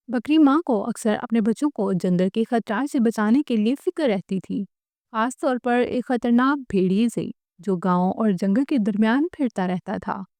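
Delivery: ordinary delivery, nothing marked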